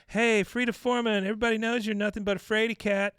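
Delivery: high, loud taunting of Frida Foreman; trash talk. Retold or imitated. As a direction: call her out like a schoolyard bully. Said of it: "She goes".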